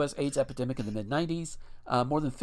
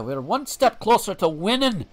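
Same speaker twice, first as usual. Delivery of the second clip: louder, hammed-up Scottish-Irish brogue; rallying cry in a vague Scottish-Irish accent; 7 semitones higher, 8 dB louder than usual. Cursing Reagan, belted out in a put-on Scottish-Irish accent. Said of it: "I was going Scottish or Irish there".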